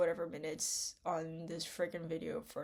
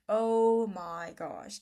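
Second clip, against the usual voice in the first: louder, slow, stretched-out Oh my gosh; drawn-out exasperated cry; 2 semitones higher, 9 dB louder, much slower than usual. Real, not acted. Vlogger exasperated about online mental health talk, loud slow groan. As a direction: let the second Oh my gosh burst out long and loud.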